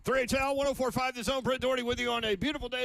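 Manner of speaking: upbeat radio intro; radio announcer. Real, not acted. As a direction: announce the show brightly.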